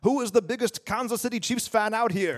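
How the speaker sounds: put-on high, thick German accent announcer. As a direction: hype the crowd in a German accent. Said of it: "thickest German accent going".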